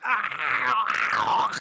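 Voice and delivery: sudden high yelp; startled cry. Acted. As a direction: cry out in alarm. Scream acted in story.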